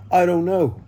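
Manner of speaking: high, loud helpless answer; clueless midwife. Retold or imitated. Retold second midwife.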